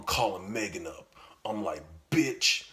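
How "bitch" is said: louder, higher angry snap; hungry boyfriend barking at his late girlfriend. Retold or imitated. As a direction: bark it down the phone. Angry phone call.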